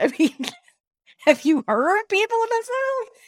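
high incredulous question through laughter; laughing. Real, not acted. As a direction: crack up while asking. Laughing over a criticism of accents.

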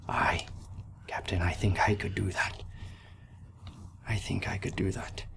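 breathy whispered reply; Riley humbly accepting his captain's offer. Acted. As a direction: answer in a hushed whisper.

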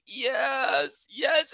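imitated high tearful yes; mock crying. Trapped woman answering the medic, imitated crying. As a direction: play a crying victim, half laughing.

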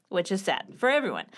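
ordinary delivery

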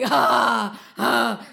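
two loud, open roars; triumphant roars. A narrator's reading. Narasimha roars over the slain demon, loud. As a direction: roar out in short bursts. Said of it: "and he was roaring".

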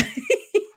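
a quick high burst of laughter; short laugh. Real, not acted. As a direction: laugh at your own joke. Laughs at own joke about friend.

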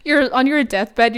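ordinary talk, nothing marked

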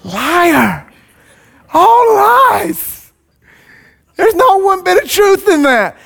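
scoffing liar. Real, not acted. Pastor mocking Aaron's excuse.

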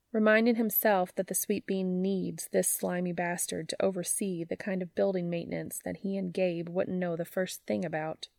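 ordinary delivery

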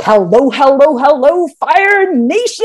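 big, drawn-out podcast host greeting; high, loud, hammed-up show opener; John Lee Dumas. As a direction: imitate a hyped host hailing listeners. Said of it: "I don't do a good accent".

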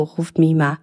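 ordinary delivery

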